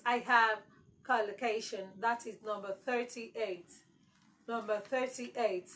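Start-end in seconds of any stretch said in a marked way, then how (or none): none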